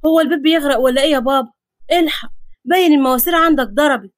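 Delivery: fast, alarmed blurting; hurried, flustered outcry; startled panic. Acted. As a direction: jolt awake in sudden alarm. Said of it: "قام اتفزع وهو بيقول".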